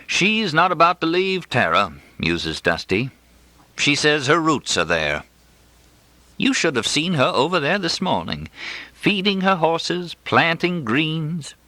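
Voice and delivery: fond musing neighbor, lightly voiced; a hint of old Southern doctor. A narrator's reading. Dusty musing to Mother about Lola, lightly voiced.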